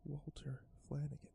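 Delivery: whispered under the breath; muttered. Acted. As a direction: barely voice the name Walter Flanagan. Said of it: "I muttered under my breath".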